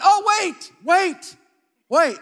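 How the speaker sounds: loud, high acted shout; excited outburst of remembering; sudden realization. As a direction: call out as the idea hits you. Acting out his sudden realisation.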